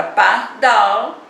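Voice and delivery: pardal in two slow parts, DAL held; lingering on DAL. Shown as a demonstration. Teacher reviewing the word's stress, drawn-out DAL in pardal. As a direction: separate par and DAL, stretching DAL. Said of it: "demorou mais um pouquinho no dal".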